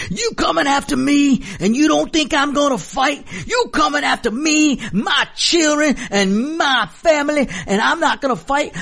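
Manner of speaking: high, shouting imitation of a furious congressman; imitated shout. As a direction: yell it like a heckled politician losing it. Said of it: "scream at the top of his lungs and say".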